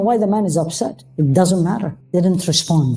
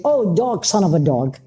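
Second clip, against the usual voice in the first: belittling. Shown as a demonstration. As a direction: say it as a contemptuous put-down. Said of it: "the tone is belittling".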